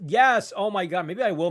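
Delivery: sudden high excited shout. Real, not acted. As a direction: burst out with delight. Excited by a chat idea.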